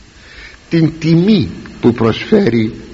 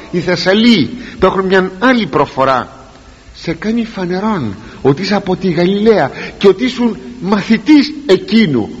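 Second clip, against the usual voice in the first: usual speaking voice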